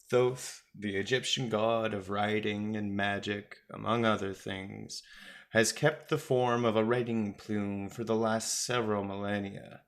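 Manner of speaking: plain reading voice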